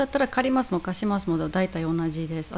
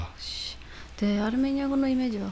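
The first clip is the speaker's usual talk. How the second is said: ordinary delivery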